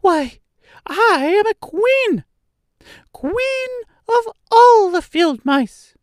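very high, slow, proud squeak; indignant squeaky queen. A narrator's reading. Mouse declares her royal rank to the Woodman, indignant squeak. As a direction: proclaim it as an affronted tiny mouse. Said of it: "cried the little animal indignantly".

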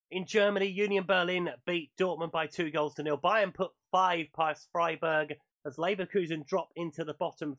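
radio sports roundup read; news read. Acted. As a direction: deliver the results like a bulletin. Reads the weekly football roundup.

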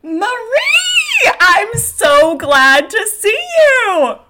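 high, loud squeal of delight; hammed-up joyful shriek; overjoyed greeting. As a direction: shout a gushing, stretched-out welcome. Dora greets Marie, runs to embrace.

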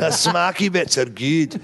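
broad Scottish take, SMACK stretched into "smarky"; mock Scottish accent. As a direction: put on thick Scots, amused. Mock Scottish repeat of line.

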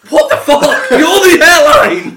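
high, loud, slow laughing yell; incredulous outburst. Retold or imitated. Retold outburst at airline.

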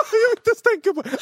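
high, laughing protest; squeamish laughing. Real, not acted. High laughing protest while friends describe the toothpick. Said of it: "jag blir svettig av att tänka på det".

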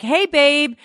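high sing-song imitation of what he should have said; mock husband voice. Wife mocking husband who took the last bottle, high mock voice. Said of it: "how could you take the last bottle and not say".

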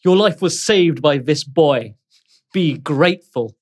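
a hard order to the prisoner; stern captain. Acted. Captain sternly orders Grode to be grateful. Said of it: "He, uh, turns to Grode and he says".

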